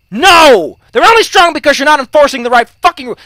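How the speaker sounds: loud, high, indignant shout; exasperated rebuttal. Real, not acted. Shouting back at players who don't enforce rules, exasperated.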